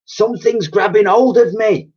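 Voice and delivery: high, loud panicked yell. A narrator's reading. Old cleaner grabbed in the dark, yelling. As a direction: cry out in alarm. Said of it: "yelled Dotty".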